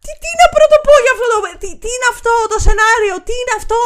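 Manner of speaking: high, loud, sputtering disbelief; exasperated outburst. Real, not acted. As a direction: burst out as if lost for words. Ranting at the film's script. (αγανακτισμένο ξέσπασμα)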